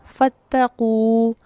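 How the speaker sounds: फ़त्तकू drawn out very slowly, long final u; original word slowly. Shown as a demonstration. Teacher answers own question with the original word, very slow. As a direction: stretch each syllable of फ़त्तकू. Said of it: "लफ्ज़ क्या था?".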